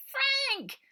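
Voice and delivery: high delighted shout of a name; joyful cry. A narrator's reading. Robert seeing his dog again, high happy cry. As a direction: cry out with joy.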